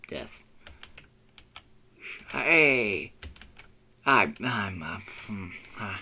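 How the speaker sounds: ordinary talk, nothing marked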